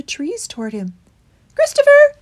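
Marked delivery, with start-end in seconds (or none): 1.5-2.2 high, loud, urgent mouse. A narrator's reading. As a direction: cry the name out high and urgently. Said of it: "he panted".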